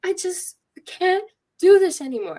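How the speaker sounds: high, loud cry of despair; retold crying outburst. Patient breaking down before the urgent care doctor, crying outburst. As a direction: re-enact a patient at the end of their rope. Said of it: "And I'm crying".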